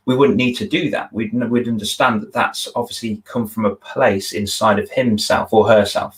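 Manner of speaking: ordinary talk, nothing marked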